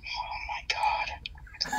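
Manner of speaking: slow, hushed whisper of awe; awed whisper. Real, not acted. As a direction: breathe it out in awe. Awed reaction to Empress Chili.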